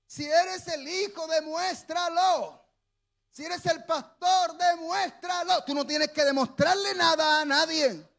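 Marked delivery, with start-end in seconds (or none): 0.1-2.6 devil's taunt, slow and shouted; mocking taunt. Preacher voicing the devil daring Jesus, slow shouted taunt. 3.3-5.6 high shouted challenge; mocking dare. Preacher voicing critics daring the pastor, shouted taunt.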